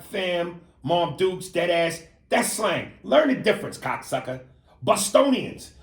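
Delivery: ordinary talk, nothing marked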